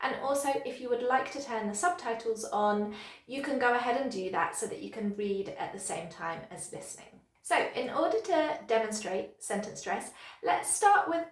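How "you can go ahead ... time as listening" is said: ordinary talk, nothing marked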